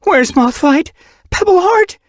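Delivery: very high, loud, panicked calls; Pebbleheart? rising in a frantic shout; horrified calling. Acted. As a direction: cry out for the healers in terror. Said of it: "eyes wide with horror".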